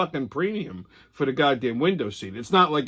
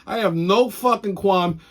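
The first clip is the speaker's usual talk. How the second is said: normal talking voice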